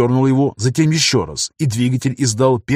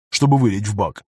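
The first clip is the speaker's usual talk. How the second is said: ordinary delivery, nothing marked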